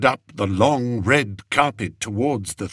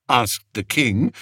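usual speaking voice

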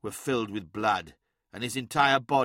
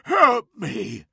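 high, loud terrified gasp; gasped plea; 9 semitones higher, 7 dB louder than usual. A narrator's reading. Hastar in the grip of change, terrified gasp. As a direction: choke out a plea for help. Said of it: "gasped Hastar".

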